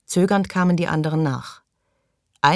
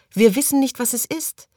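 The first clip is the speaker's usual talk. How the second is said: higher urgent warning, dropping at the end; anxious call; 9 semitones higher than usual. A narrator's reading. Maggie urges Morley not to enter, anxious. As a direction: warn him anxiously. Maggie warns, then catches up.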